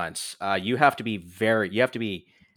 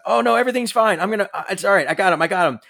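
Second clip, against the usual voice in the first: high, louder, hurried cover-up act; pretend reassurance; 10 semitones higher, 6 dB louder, faster than usual. Jackie pretending to fight off a knocked-out fake assassin, high false reassurance. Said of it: "Jackie pretends, like".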